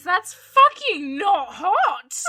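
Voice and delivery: slow, loud, high protest; indignant outburst. Real, not acted. Host stuck on Canadians avoiding twenty degrees, loud indignant protest. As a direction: shout it emphatically, drawing out the words.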